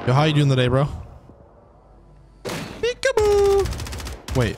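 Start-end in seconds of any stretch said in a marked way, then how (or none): 2.4-3.7 high, drawn-out singsong peekaboo; playful taunt. Pretend. Player surprising an enemy, slow high teasing peekaboo. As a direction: tease him as you pop out.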